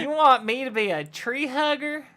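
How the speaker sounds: high, loud, affronted question; mock outrage. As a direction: throw it back in hammed-up indignation. Acted outrage at being tree hugger.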